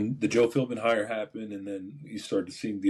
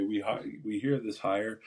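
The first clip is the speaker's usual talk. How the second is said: ordinary delivery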